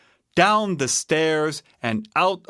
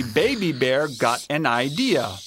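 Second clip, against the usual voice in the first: plain reading voice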